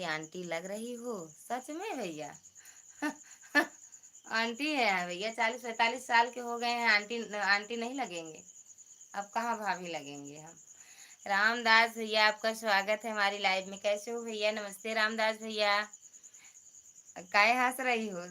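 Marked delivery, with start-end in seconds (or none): 2.4-4.3 high breathy giggle; high laugh. Real, not acted. Host giggles at a viewer calling her aunty. 11.3-15.8 louder, fast cheerful greeting; loud welcome. Real, not acted. Host greets viewer Ramdas loudly on live.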